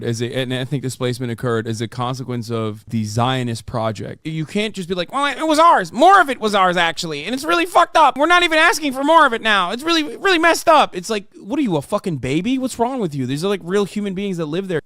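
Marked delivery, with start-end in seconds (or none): 5.1-11.0 lightly whiny, higher mock complaint; a hint of a sulking child; mock whiny crying. Mocking the debater as a crybaby, higher and whiny. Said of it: "he's literally crying".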